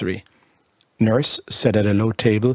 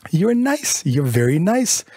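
put-on jumps much higher, tender baby talk; cooing; 7 semitones higher, slower than usual. Bertha kissing her baby, cooing up high.

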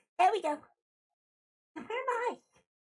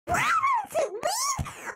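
panicked; 11 semitones higher, much slower than usual. Real, not acted. Panicking over a stuck screen.

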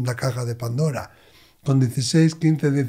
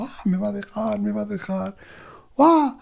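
imitated crying; 6 semitones higher than usual. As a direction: imitate a tearful teen girl's lament. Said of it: "y estaba ahí llorando". (imitando a la chica llorando, gimoteo agudo)

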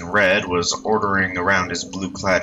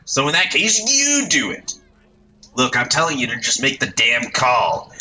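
put-on much higher, brash slangy young voice; bossy delinquent ordering his pal; cocky street punk; 7 semitones higher than usual. Youth in red bossing his companion, brash punk voice.

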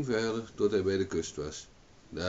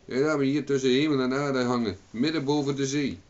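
loud, raised complaint; indignant shout; 6 semitones higher, 6 dB louder than usual. A narrator's reading. Owen left hanging over the sea, indignant shout. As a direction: protest loudly, pitch up all through.

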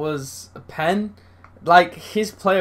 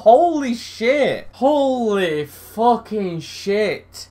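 stunned disbelief; 5 semitones higher, much slower than usual. Real, not acted. Stunned by second penalty.

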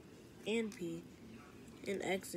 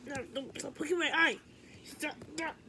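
pained protest; 6 semitones higher, faster than usual. Acted. Being poked in the eye.

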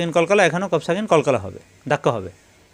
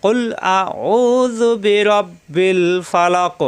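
correct recitation; 3 semitones higher, much slower than usual. Shown as a demonstration. Teacher modeling the correct recitation for students. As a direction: chant কুল আউযু বিরাব্বিল ফালাক slowly and correctly.

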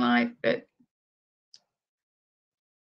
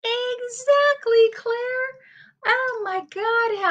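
mock outrage; 12 semitones higher, slower than usual. Real, not acted. High slow exasperated reaction.

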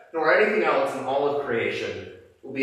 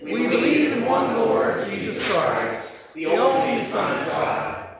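higher, steady communal recitation; creed recited in unison; 5 semitones higher than usual. Real, not acted. Congregation standing, reciting the Nicene Creed in unison. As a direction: speak the creed together, measured and even.

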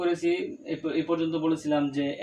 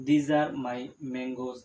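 very slow, word by word; teacher modelling a plural sentence slowly; slow model sentence; 4 semitones lower, much slower than usual. Shown as a demonstration. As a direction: spell out "These are my books" slowly. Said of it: "এইগুলো আমার আম".

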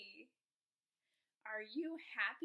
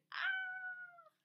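high-pitched shriek of pretend fright; mock scared scream; 4 dB louder than usual. Shown as a demonstration. Teacher acting out scared for her class, high mock scream.

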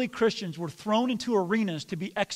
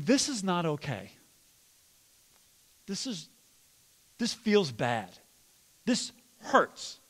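calm problem talk. Shown as a demonstration. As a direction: model a calm complaint. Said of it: "Thinking things like what I'm about to say".

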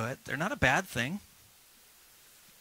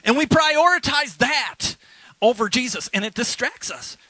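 preaching shout; 8 semitones higher, 10 dB louder than usual. Real, not acted. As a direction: drive the point home at full force. Preacher hits his point hard.

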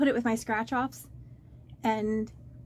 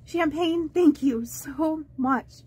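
ordinary talk

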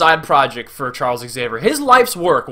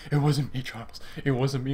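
hushed imitation of grieving Logan; quiet, broken-up reassurance, acted; soft acted plea; 2 semitones lower, 9 dB quieter than usual. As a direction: plead softly, fading out on the name. Voicing Logan carrying dying Charles.

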